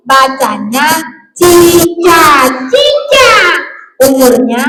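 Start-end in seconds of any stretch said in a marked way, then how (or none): 2.7-3.3 high singsong puppet voice; squeaky echo; puppet pupil. Acted. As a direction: parrot the name back very high. Puppet repeats the correct reading.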